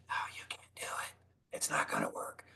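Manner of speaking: unvoiced whisper of the doubting voice. Whispering the doubting inner voice to the audience. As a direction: hiss it like the little man on your shoulder. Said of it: "Stupid little man telling you".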